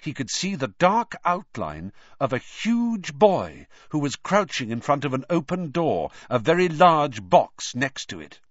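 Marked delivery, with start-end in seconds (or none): none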